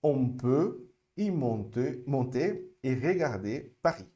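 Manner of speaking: slow, careful French for repeating; slow model sentence. Shown as a demonstration. Teacher modelling French for students to repeat, slowly. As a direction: lay each word out slowly for them to echo. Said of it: "Repete comigo mais uma vez".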